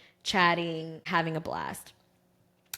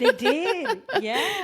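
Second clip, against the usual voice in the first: higher, laughing yes; 11 semitones higher, 5 dB louder, much slower than usual. Real, not acted. Host confirming the birth date mix-up, laughing. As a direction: laugh through it.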